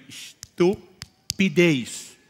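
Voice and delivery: Estupidez drawn out very slow and loud, syllable by syllable; stretched emphasis. Retold or imitated. Pastor repeats Estupidez to the stunned brother, very slow and stressed. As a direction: lean hard on Estupidez, stretching every syllable.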